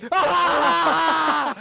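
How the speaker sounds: sudden loud yelp of pain; pained scream. Real, not acted. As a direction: cry out as the shock hits. Zapped again.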